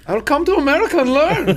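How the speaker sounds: high, loud mock Norwegian accent. Teasing a colleague from Norway in a mock Norwegian accent. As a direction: put on a hammed-up Norwegian accent. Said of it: "Bro, that accent".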